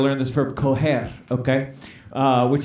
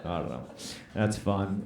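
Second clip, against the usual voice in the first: muttered aside; 8 semitones lower, 12 dB quieter than usual. Real, not acted. Mutters to himself after the joke.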